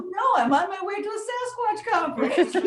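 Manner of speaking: bubbling laughter while retelling a Texas Sasquatch chat; laughing. Retold or imitated. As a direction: giggle the line out, amused at the story. Laughing while retelling own reply.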